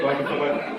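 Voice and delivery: ordinary talk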